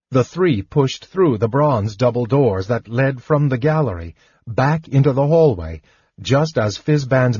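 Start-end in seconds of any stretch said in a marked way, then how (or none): none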